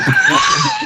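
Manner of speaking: high shrieking laugh; loud burst of laughter. Real, not acted. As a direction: crack up loudly. Laughing at tiny loot.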